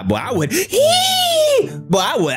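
high squealing hee of lust; excited squeal. Real, not acted. Reacting to a fine woman, high excited squeal. Lusting over woman in clip.

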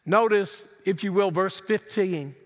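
ordinary delivery

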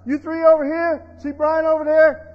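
preacher lightly voicing a commander; God giving orders. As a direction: dispatch helpers with loud instructions. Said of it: "When God says".

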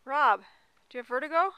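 loud teasing call across the trail. Real, not acted. Calling to Rob ahead on the narrow path, loud and teasing. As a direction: shout it over to him with a grin.

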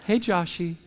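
soft, coaxing pet-name voice; gentle wake-up. Retold or imitated. Wife gently waking husband, soft and coaxing. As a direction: coax him awake gently. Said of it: "she'll just kinda be like".

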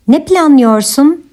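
normal talking voice

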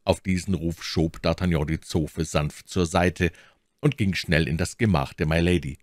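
ordinary delivery

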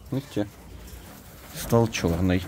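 normal talking voice

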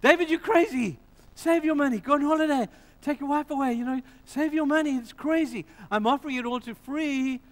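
Araunah voiced. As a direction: cry it out as if he's lost his mind. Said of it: "and Araunah says".

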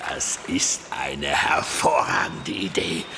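slow, unvoiced villain whisper; scheming whisper. Pirate captain approving the kidnap plan in a slow scheming whisper. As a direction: hiss the plan slowly, conspiratorial.

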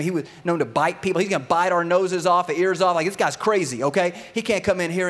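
loud, high-pitched mock panic; panicked group protest, hammed up; freaking out. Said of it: "freaking out".

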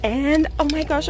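ordinary talk, nothing marked

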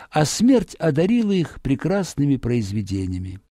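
plain reading voice

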